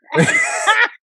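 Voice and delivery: high screaming laugh of disbelief; shrieking laugh. Real, not acted. Disbelief at the opponent.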